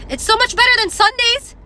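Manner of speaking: high, loud gushing; delighted. Retold or imitated. Shopper gushing about the empty lot, high and loud. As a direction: squeal with delight. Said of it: "I was like".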